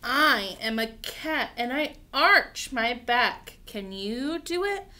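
slow, loud picture-book read-aloud; reading to children. Acted. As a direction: stretch each word out boldly for little ones.

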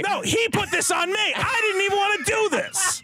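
high, shouted mock protest; hammed-up indignant denial, voice shooting up; staged fight. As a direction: shift the blame in a pretend shouting match. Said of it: "We have to fight on each other".

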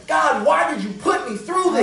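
high, loud cry to God; anguished shout. Retold or imitated. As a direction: demand it in anguish. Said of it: "we thought to ourselves".

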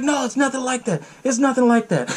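high-pitched mock protest; hammed-up high voice, backpedalling; panicked denial, retold. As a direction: play a caught-out boyfriend pleading innocence. Retold boyfriend denies he was drinking.

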